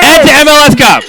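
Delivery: high, loud victory shout; cheering shout. Real, not acted. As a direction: shout the toast to the crowd. Toast at celebration party.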